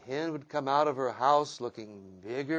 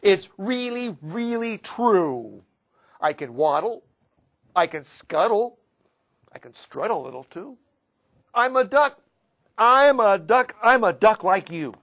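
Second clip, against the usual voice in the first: silly stranger duck voice; 7 semitones higher, 13 dB louder than usual. Acted. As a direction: boom it out like a proud fake duck. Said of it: "says the stranger".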